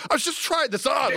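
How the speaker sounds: high sheepish mock voice; mock sheepish friend. Friend caught trying on clothes, high mock sheepish.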